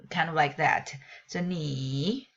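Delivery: ordinary talk, nothing marked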